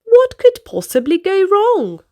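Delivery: ordinary delivery, nothing marked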